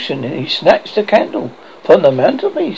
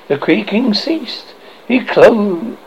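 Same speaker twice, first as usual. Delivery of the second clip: ordinary delivery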